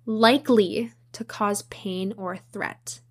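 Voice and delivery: slow down and lean on "likely"; slow quoted definition with "likely" set apart and stressed. Real, not acted. Host reading out what fear means, slowly stressing "likely". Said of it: "I also emphasize the word likely".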